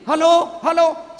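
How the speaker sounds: loud high hello into a phone; shouting into phone. Retold or imitated. Villager shouting into phone upside down.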